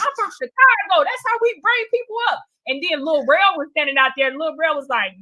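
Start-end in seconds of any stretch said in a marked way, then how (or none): 0.0-2.4 high, quick mock host shrugging it off; dismissive host. Host explaining his rough intro, mock offhand voice. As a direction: toss it off like it's nothing. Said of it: "he said".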